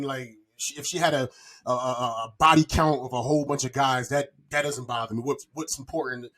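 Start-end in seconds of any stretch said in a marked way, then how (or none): none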